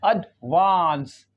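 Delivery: एडवांस as slow "ud-VAANS", long "aa"; British pronunciation. Shown as a demonstration. Teacher modelling the word, slowly with long aa. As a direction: split एडवांस, short "ud" then drawn-out "vaans". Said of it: "थोड़ा longer पढ़ेंगे".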